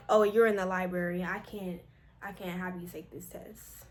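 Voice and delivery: low, soft official refusal; imitated proctor. Proctor refusing her test in the library, low and quiet. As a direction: drop low and quiet, apologetically firm.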